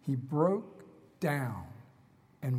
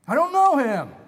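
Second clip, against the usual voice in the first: loud, high, panicked cry; a frightened man yelling his denial; desperate denial; 8 semitones higher, 12 dB louder than usual. Acted. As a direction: shout the denial as if cornered. Peter's terrified denial acted out.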